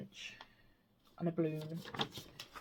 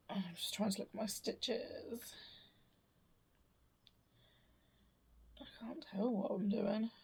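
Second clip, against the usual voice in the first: muttering to herself; 3 semitones higher, 10 dB quieter than usual. Real, not acted. Peering closely at stitches.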